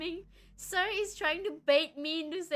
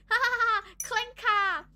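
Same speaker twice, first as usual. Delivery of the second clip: high, loud mocking cackle of a word; mocking laugh; 6 semitones higher, 8 dB louder than usual. The lone human mocking the clankers, loud jeering laugh. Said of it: "was like".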